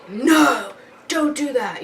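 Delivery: sharp no. Retold or imitated. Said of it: "hard not to be like".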